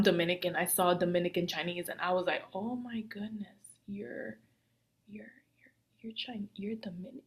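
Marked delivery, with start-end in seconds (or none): 2.5-7.2 hushed, stammering disbelief; retold stunned reaction. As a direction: trail into a near-whisper, too stunned to finish.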